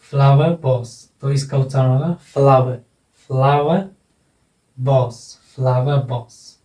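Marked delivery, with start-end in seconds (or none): 0.0-1.1 फ्लावर box said as "flawe box"; R-less फ्लावर leading into box; silent final R. Shown as a demonstration. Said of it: "रे का उच्चारण नहीं होगा". 2.2-6.6 फ्लावर ending in a vowel, "flawe", before box; silent final R. Shown as a demonstration. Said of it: "रे का उच्चारण नहीं होगा".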